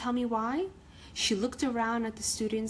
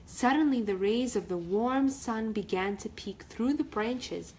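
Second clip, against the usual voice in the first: usual speaking voice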